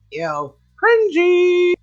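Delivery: ordinary talk, nothing marked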